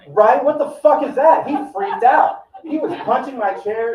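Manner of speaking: rising outrage at the absurdity; excited incredulity. Real, not acted. Excited retelling.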